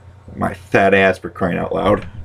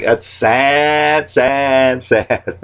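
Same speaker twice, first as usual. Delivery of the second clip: mock sad catchphrase; 5 semitones higher, much slower than usual. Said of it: "my new saying".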